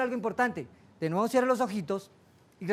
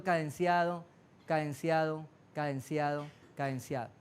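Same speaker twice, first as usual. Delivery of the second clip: each cadenciado lower and softer; fading cadence; 5 semitones lower, 6 dB quieter than usual. Shown as a demonstration. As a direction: let cadenciado sink into silence. Fading rhythmic repetition. (cadenciado cada vez más bajo y suave)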